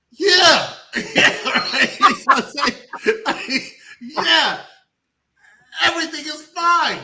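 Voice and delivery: high laughing "Yeah", then a loud "Everything is fine"; laughing mockery. Laughing at the ghost mom's fake calm, mock reassurance.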